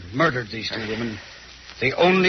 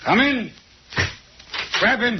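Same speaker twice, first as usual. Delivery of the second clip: sudden command; 9 semitones higher than usual. Acted. Detective springs the trap.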